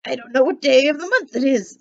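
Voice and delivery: put-on old Scrooge, loud and giddy with joy. Scrooge calling out in delight on Christmas morning. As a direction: bounce the words out like a thrilled old man.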